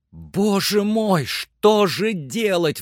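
high, drawn-out cry of despair; despairing exclamation. A narrator's reading. Master hears the branch is dead, despairing cry. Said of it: "в отчаянии".

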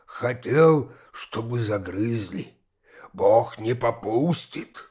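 slow, low holy-fool voice; chewing and murmuring. A narrator's reading. Holy fool showing his torn clothes, chewing as he chants. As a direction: intone each phrase slowly and low, pausing between. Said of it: "пережёвывая, приговаривал".

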